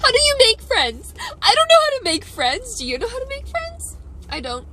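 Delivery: high, tearful pleading while crying; breaking down in tears; really crying, pitch climbing. Real, not acted. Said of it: "and I'm still crying".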